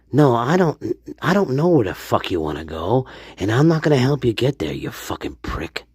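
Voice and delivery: fast, put-on rude assistant; quick comic gadget telling someone off; mock Stanley Alexa. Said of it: "this is Stanley, Alexa".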